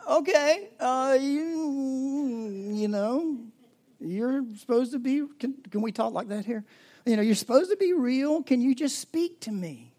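high, strained pleading to God; pleading prayer. Pretend.

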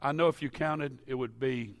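normal talking voice

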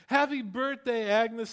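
high, loud happy-birthday shout; group shout of happy birthday. Retold or imitated. Everyone in the diner greeting Agnes, loud happy shout. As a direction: yell it like a crowd surprising her. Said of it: "everyone says".

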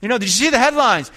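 high-pitched mock eager caller; excited phone gossip. Preacher mimicking people phoning about the headlines, excited gossip. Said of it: "You go get on the phone".

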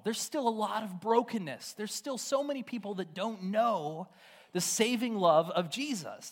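high, impassioned preaching, pressing the point; preaching at full force. Acted. Preacher urging the congregation that God is with them, impassioned and high. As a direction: rise up and press the question to the congregation.

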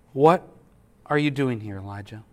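soft and low, fading almost to nothing; gentle whisper. Acted. God's voice asking Elijah in the cave, a soft low near-whisper. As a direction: ask it like a still, small voice. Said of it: "the voice said to him".

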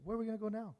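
soft, resigned question and reproach; resignation. Acted. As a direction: say it as if giving up. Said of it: "It was almost like resignation".

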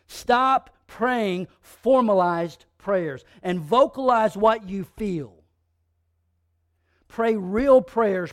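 slow, loud, punched words; preacher driving the point, slow and loud; forceful preaching. Real, not acted. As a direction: hammer each word home from the pulpit. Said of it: "preaching at full force".

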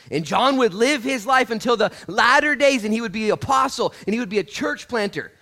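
very loud, high-pitched proclamation; shouted preaching. Real, not acted. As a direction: shout the story to the congregation.

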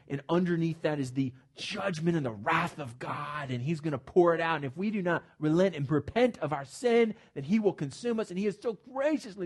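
ordinary delivery

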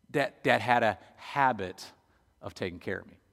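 ordinary delivery

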